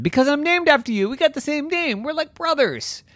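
very high, eager llama voice; eager pleading. Acted. Llama claiming kinship with Zeus, high and eager. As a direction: plead in a high-pitched rush.

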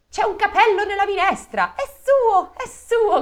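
very high, loud accusing cries; crowd shouting. Acted. Diners shouting at the hair in the soup, high loud cries. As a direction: cry out together in outrage. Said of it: "gridarono tutti".

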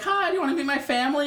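mock high teen girl, quick and eager; girlish voice. Teen girl at a show asking him, mock girlish. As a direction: imitate a bubbly young girl. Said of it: "she's like".

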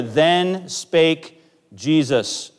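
"Then spake Jesus" drawn out slowly, each word weighted; slow emphatic quote. Acted. Pastor pointing the church to the verse, slow and weighty. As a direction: lean on every word, slow.

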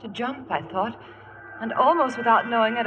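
ordinary talk

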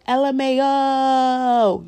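high, loud, stretched-out letters; drawn-out text reply. Retold or imitated. Voicing friend's texted laughing reply.

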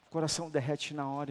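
ordinary talk, nothing marked

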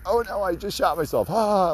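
high, hammed-up fake alarm; mock panic. Jose fumbling a card, mock panic, pitched high. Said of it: "just completely joking".

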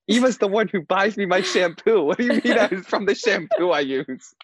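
playfully retold indignation; amused mock protest. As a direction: protest in disbelief, pitched well up. Acted protest to dad's explanation.

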